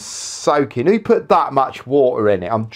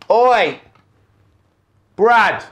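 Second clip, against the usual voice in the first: calling out; 8 semitones higher, 4 dB louder than usual. Real, not acted. Calling to Brad elsewhere.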